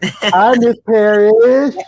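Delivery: high, slow, sing-song hello; a lovestruck teen drawing out his greeting; mock teen boy greeting. Teen boy greeting his friend's mom in a high, drawn-out voice.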